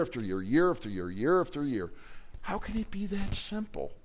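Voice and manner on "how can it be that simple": high, soft, puzzled question; incredulous, pitched up. Retold or imitated. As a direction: ask it high and quiet, half to yourself. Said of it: "that's what people are taught".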